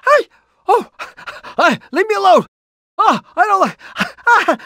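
high-pitched frightened pleading; shrill, panicked cries; panicky high yelp. Acted. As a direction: squeal it out like a scared little girl. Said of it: "I'm screaming like a little girl".

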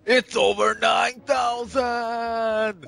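drawn-out, slow dramatic shout; Vegeta meme voice. Pretend. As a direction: stretch it out like Vegeta. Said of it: "Vegeta, what's the water level".

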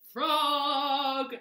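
long, loud, high call; crying out. A narrator's reading. Toad calls for his lost friend, long loud cry. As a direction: holler the name, stretching it out. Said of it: "cried the thing".

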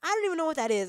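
high, loud, baffled cry at the screen; yelping in disbelief at the game; baffled outburst. Real, not acted. Gamer yelps at a sudden death, baffled and loud.

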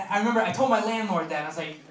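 normal talking voice

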